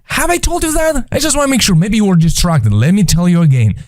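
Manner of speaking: high-pitched, comic exasperated reminder; God. Acted. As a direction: rhetorical nagging in a high put-on voice, easing down. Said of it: "he has a sense of humor".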